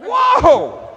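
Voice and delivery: loud startled whoa; a loud stunned whoa; shocked whoa. Real, not acted. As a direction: blurt it out in shock. Reacting in shock.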